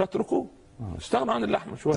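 put-on high, emphatic voice of the old scholar; quoted sage's advice. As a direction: say it like a wise elder giving advice. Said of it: "قال".

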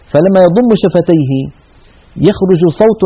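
normal talking voice

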